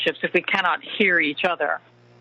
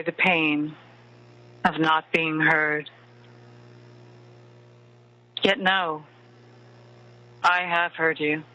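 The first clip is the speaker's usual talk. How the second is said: right in your ear. Acted. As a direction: murmur close like a lover at bedtime. Leads a bedtime love meditation.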